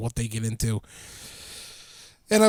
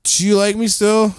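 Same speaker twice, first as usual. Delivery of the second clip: put-on higher and louder needy drunk plea; drunk friend impression; 5 semitones higher, 5 dB louder than usual. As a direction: beg for reassurance like a drunk friend. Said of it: "Rob did Snow Angels and said".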